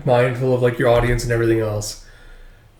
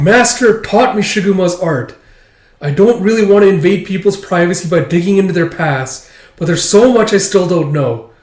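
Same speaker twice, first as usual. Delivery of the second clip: raised, urgent pleading; higher, louder, insistent begging; earnest plea; 5 semitones higher, 5 dB louder than usual. Acted. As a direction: act out an impassioned appeal. Acting out Akane's plea.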